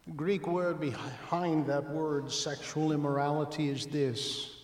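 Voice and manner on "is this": normal talking voice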